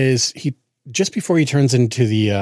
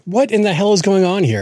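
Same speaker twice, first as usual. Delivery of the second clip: higher-pitched exasperated outburst; mock freak-out; 7 semitones higher than usual. Listener freaking out at the song, mock exasperated. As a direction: play up baffled disbelief. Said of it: "the entire time I'm freaking out".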